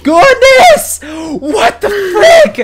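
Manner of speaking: very high, loud, drawn-out yell; exasperated outburst. Real, not acted. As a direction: yell each word in slow outrage. Outburst at being attacked.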